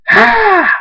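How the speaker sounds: very high, loud scream; screamed effort. A narrator's reading. Thunderstorm screams fighting the tornado, forceful high scream. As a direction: let out a forceful burst of scream. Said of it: "Thunderstorm screams".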